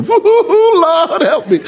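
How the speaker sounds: high, drawn-out mock wail; hammed-up sobbing plea for mercy; Hollywood crying. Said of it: "be Hollywood on that thing".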